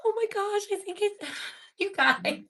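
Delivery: high, breathless laughing disbelief; astonished, breaking into a little laugh; stunned amazement. Real, not acted. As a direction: react in real shock, voice jumping up. Reacting to a ghostly voice.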